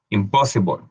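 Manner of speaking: Impossible ending in a dark -bol; English word demo. Shown as a demonstration. Teacher models the word for students. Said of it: "la L E se va a convertir en ol".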